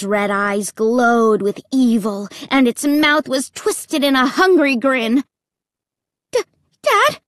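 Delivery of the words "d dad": high, startled stammer; frightened child's stuttered call. A narrator's reading. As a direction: stumble on the first sound, pitch leaping up. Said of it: "I finally managed to stammer".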